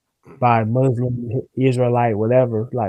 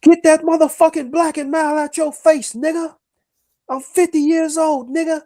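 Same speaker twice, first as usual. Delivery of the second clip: much higher, barking drill sergeant; 3 dB louder than usual. Retold or imitated. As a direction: bark orders like a drill sergeant. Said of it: "He sound like a drill sergeant".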